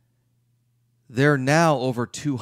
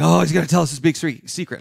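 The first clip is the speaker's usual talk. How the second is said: louder, fast, hammed-up excitement; mock excited; 4 semitones higher, 6 dB louder, faster than usual. Attendees freaking out over a secret, mock excited. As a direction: blurt it like the big reveal is coming. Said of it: "Everybody kinda starts freaking out".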